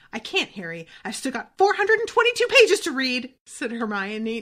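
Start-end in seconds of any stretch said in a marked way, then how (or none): 0.1-3.3 overwhelmed student, pitch and volume shooting up; acted hysteria building on the page count; slightly hysterical. A narrator's reading. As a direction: climb high and loud in rising panic. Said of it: "now sounding slightly hysterical".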